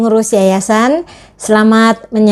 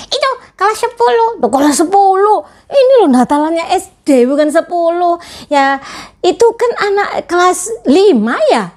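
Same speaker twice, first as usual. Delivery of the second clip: put-on much higher childlike puppet voice; puppet friend; 8 semitones higher than usual. Teacher voicing her puppet for the children, high puppet voice. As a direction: play the cheeky puppet sidekick, pitched way up.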